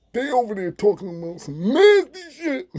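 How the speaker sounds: high, loud, slurred mock deaf speech; mimicking Habao. Deaf inmate Habao reporting overheard talk, high mock deaf voice. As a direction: gossip it high like a deaf man. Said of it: "He like".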